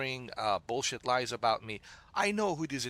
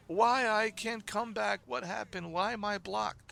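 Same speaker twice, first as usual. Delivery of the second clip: mock crying complaint; 8 semitones higher than usual. Streamer mocking a blocked viewer who wants back in, mock whining. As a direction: mimic a crybaby pleading, pitched way up. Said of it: "stop crying to me".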